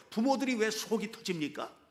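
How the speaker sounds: ordinary talk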